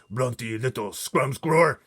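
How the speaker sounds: loud booming giant insult; shouting giant. Acted. Blood Bottler insulting the BFG, shouting. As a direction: bellow the insults. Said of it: "he shouted".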